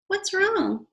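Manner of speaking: high worried question; high caring kid; concerned boy. Acted. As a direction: ask gently but keenly. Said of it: "asked Stanley".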